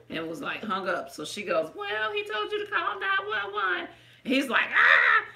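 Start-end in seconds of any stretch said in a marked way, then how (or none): 1.7-3.9 high mock clerk voice, much higher; lightly imitating the cashier, pitched up; quoting the clerk in a high voice; mimicking the clerk. Clerk voiced high in retold story. 4.7-5.2 sudden high loud "Ah!"; mock startled shout; startled yell. Man's startled yell retold.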